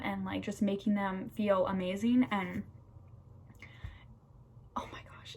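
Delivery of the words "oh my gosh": hushed whisper. Real, not acted. Nursing student telling viewers about the birth, in an awed whisper. Recalling the overwhelming birth moment.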